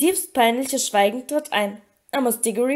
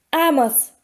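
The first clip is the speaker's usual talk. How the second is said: louder, firm call of his name; 3 semitones higher, 6 dB louder than usual. A narrator's reading. Mr Weasley interrupting Amos Diggory, loudly. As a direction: cut in sharply. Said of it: "sagte Mister Weasley laut".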